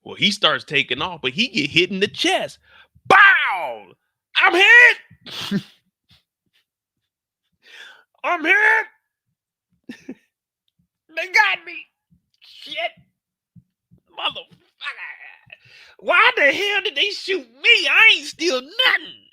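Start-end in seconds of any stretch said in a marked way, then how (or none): 3.1-3.9 loud mouth gunshot "bow"; gunshot noise. Retold or imitated. Telling the story of the pilot getting shot, mouth gunshot. 4.4-19.3 high, loud panicked yelling as the pilot; shot pilot. Retold or imitated. Pilot hit in the chest yelling at the crew, high panicked shout.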